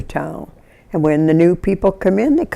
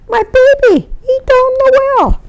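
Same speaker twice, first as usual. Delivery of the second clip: piercingly high imitated wail, louder; mother screaming; 4 dB louder than usual. Mother telling the neighbour about the well, screaming. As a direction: re-enact a desperate mother crying for help. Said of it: "the mother was just screaming".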